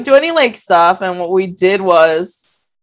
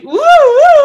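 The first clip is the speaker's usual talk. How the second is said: put-on much higher, louder drunken woo; tipsy cheering; 7 dB louder than usual. Tipsy narrator cheering in the truck, high loud whoop. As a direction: whoop it up like a tipsy bar-goer. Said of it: "a little drunk from the bar".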